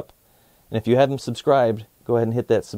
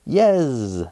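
short buzzy fly yes; 2 semitones higher than usual. Acted. Fly Guy answers Buzz, buzzy fly voice.

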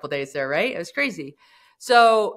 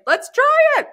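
carefree; 8 dB louder than usual. Retold or imitated. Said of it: "Gen two point oh is like".

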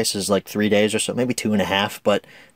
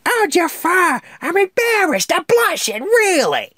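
Iago. Retold or imitated. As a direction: play the fawning cartoon parrot, high and brash. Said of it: "another great vocal performance here".

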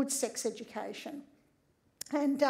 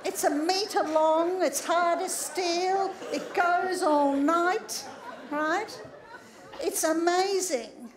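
mock awe; 4 semitones higher, 3 dB louder, slower than usual. Mocking the fantasy man's equipment.

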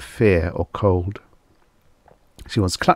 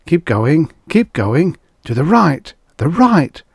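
urgent, high and louder, in short bursts; impatient voice; 8 semitones higher, 5 dB louder than usual. A narrator's reading. Unseen voice steers the fleeing man, impatient. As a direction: urge him on impatiently, pitched up. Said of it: "its tone was impatient".

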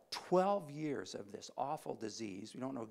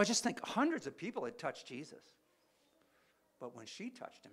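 ordinary delivery

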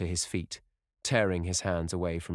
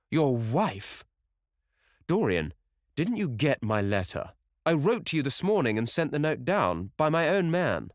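agitated disbelief, rising higher and louder; astonished alarm; 7 semitones higher, 4 dB louder than usual. Acted. Lord Henry asks Dorian about his letter, astonished and agitated. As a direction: build from shocked question to urgent protest.

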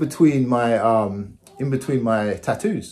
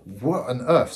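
ordinary delivery

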